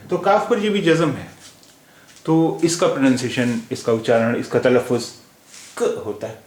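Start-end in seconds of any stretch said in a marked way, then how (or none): 5.8-6.0 क as a bare halant k, no full vowel; vowelless k. Shown as a demonstration. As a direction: say क as a short clipped half-k sound. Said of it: "हलंत वाला का होता है".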